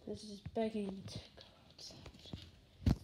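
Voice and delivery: slow hushed documentary whisper; nature documentary whisper. Pretend. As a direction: whisper it like a wildlife narrator. Mock nature documentary narration.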